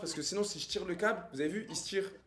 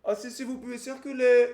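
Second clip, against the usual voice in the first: dopey cartoon bellow; 3 semitones higher, 10 dB louder than usual. Mocking stranger in broken French.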